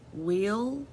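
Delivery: questioning rise on Will; rising question word. Shown as a demonstration. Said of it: "that sounds like a question".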